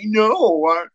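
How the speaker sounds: put-on high, slow, whimpering spoiled-child whine; whiny rich kid at Christmas. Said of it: "the rich kid at Christmas".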